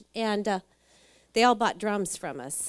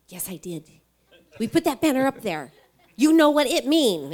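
mock broken English, louder at the end; imitating broken English; 3 semitones higher, 7 dB louder than usual. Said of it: "with a broken English".

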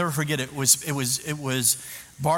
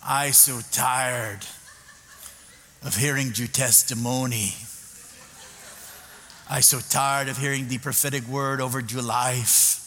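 imitating a heavy Spanish accent, a bit lower; 3 semitones lower than usual. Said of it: "And she goes".

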